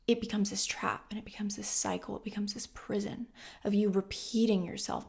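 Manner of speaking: whispered, ominous. Acted. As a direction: drop to a breathy whisper. Dark point made hushed.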